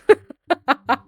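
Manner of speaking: real amused laugh. Real, not acted. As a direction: crack up at your own quip. Laughs at own quip.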